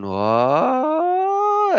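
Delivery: very high, astonished squeak; shocked kids. Retold or imitated. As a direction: play the stunned kids hearing a swear. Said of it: "I could see the kids' faces going".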